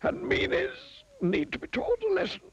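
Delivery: very high-pitched wizard voice; reproving wizard. Acted. Wizard about to punish the old meanie, reproving. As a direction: pronounce judgement in a high wizard voice. Wizard passes judgement on miser.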